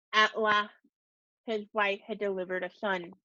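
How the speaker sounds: ordinary delivery, nothing marked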